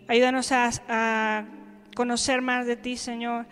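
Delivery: quiet, soft-spoken prayer; hushed congregational prayer. Acted. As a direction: pray it gently and reverently to the Lord.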